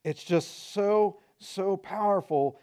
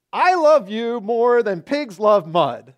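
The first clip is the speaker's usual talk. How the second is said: mock loving wife; 4 semitones higher, 8 dB louder, slower than usual. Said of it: "she says this".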